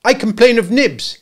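put-on high, loud childish complaint; cried promptly. A narrator's reading. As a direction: blurt it out like a tattling boy. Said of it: "he cried promptly".

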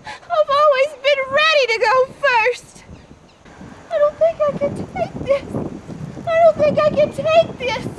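desperate, wailing protest; anguished anger. Acted. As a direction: cry out at the unfairness. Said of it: "I'm so mad!".

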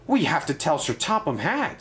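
ordinary delivery

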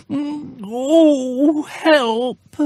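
high crying bear wail; high wail for help. Acted. Bear stuck in the gorse bush wails, high and crying. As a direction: whimper it from the prickles.

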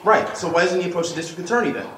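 loud, high-pitched comeback; confrontational challenge. Real, not acted. Reporter challenging an official about the district attorney, loud and confrontational.

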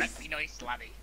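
put-on pirate accent. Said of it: "we're using a stupid voice".

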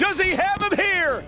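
excited call. Real, not acted. Near fall excitement.

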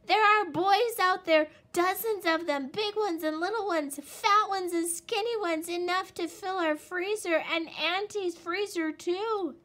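high, loud, breathless listing; excited shouting. A narrator's reading. Little Wolf bursting into the cave, excited shouting. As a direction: shout it all out at once. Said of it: "ran into the cave shouting".

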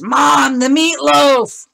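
loud, high calling up the stairs; hollering for dinner, high and loud; mock kids' yell. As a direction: kids yelling from upstairs for meatloaf. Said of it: "they scream up the stairs".

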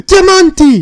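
high, very loud cry of discovery; ecstatic shout. Real, not acted. Player striking diamonds yells to partner, ecstatic shout. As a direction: yell it in real triumph. Finds diamonds while digging down.

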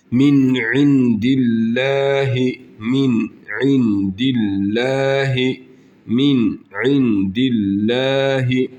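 min indillahi drawn out with a thin lam; recited slowly. Shown as a demonstration. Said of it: "Esre ise ince okuruz". (min indillahi yavaş ve ince)

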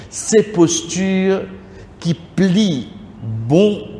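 ordinary talk, nothing marked

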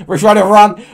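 shouting a mock warning to a viewer; mock urgent yell. Warning a chat user, laughing.

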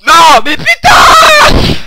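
slow, very high, loud cry; furious shout. Real, not acted. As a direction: scream in rage at losing. Rage au jeu vidéo. (hurlement de rage)

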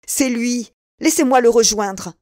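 loud, high and urgent; furious shout to be let go; angry outburst. A narrator's reading. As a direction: push past them, demanding to go. D'Artagnan straining to pursue.

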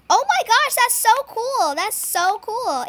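ordinary talk, nothing marked

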